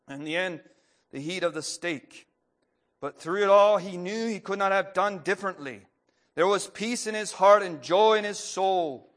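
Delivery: earnest read-aloud storytelling, building in the tense parts; story reading. A narrator's reading. Preacher reading a story to the congregation, earnest. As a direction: tell the martyr story to the congregation.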